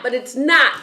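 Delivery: usual speaking voice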